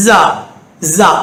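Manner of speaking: ஜா as English 'zaa'; ஜா begun with a long z; buzzing Z sound. Shown as a demonstration. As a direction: buzz a z through the teeth on ஜா. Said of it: "பல்லால".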